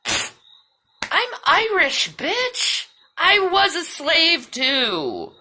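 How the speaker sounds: high, put-on voice that scoffs and then slows down; sassy mock retort. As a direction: toss it off like a snippy comeback. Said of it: "I'll be like".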